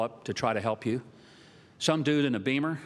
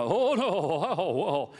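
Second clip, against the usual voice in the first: mock horror; 3 semitones higher, much slower than usual. Mock horrified northern reaction.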